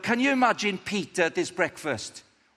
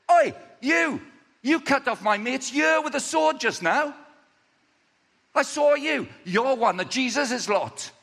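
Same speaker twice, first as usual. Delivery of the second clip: high, raised voice calling someone out; acted accuser, pitched up and loud; loud accusation; 7 semitones higher, 4 dB louder than usual. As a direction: confront the man you saw. Third person accuses Peter.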